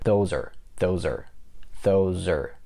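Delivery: "those are" fused, are a weak er; low, falling "those are" as one word; connected words. Shown as a demonstration. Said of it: "That's the way you connect are".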